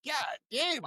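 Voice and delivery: high, soft exasperated squeak; mock dismay. Kid reacting to mom ruining his chances, in hushed mock dismay.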